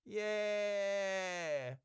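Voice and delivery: long, high, very quiet muffled cheer; muffled voice out of view. Acted. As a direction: cheer faintly from inside a closed container. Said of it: "muffled, out of view".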